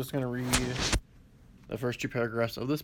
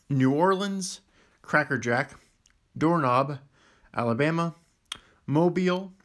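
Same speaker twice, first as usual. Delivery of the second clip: normal talking voice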